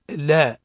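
"لا" with a clear open a, not "lee"; open lam. Shown as a demonstration. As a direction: model "لا" with a clean fatha. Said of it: "قول".

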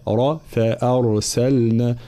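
slow فأرسلنا with a heavy r and light other letters. Shown as a demonstration. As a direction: thicken the r in فأرسلنا. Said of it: "را ساكنة مسبوقة بفتح تفخم".

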